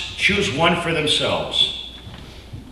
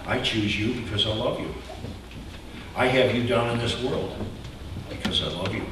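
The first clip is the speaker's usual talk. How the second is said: softer, tender and sincere; tender God voice; 3 semitones lower, 8 dB quieter than usual. Acted. God tells Israel softly why he chose them. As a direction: say it as a loving promise. God's loving words to Israel.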